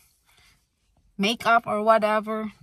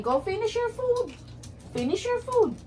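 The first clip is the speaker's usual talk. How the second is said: pet talk; 9 semitones higher, 5 dB quieter than usual. Real, not acted. Coaxing the dog to eat.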